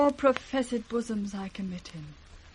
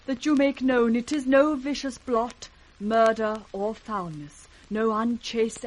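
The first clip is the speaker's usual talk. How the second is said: impassioned protest; 4 semitones higher, 8 dB louder than usual. Acted. Cordelia protests to the king.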